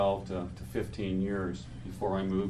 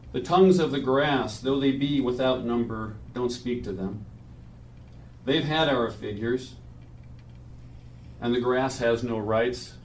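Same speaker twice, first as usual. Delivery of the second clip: measured poetry reading at a memorial; solemn reading. Acted. Reads his friend's poem aloud.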